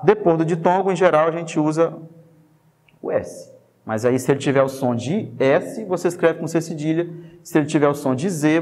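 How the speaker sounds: ordinary talk, nothing marked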